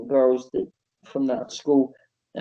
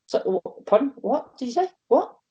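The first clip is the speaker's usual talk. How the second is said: much higher, stammering what did you say; mock disbelief; 11 semitones higher than usual. Wrestler asking the boss to repeat, stunned and high. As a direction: sputter in disbelief. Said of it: "I was like".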